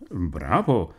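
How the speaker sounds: usual speaking voice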